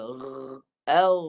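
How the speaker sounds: rrr bergetar, then a loud thick au; vibrated au. Shown as a demonstration. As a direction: buzz first, then punch au out loud. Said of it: "Gegar kat suara". (au tebal dan kuat)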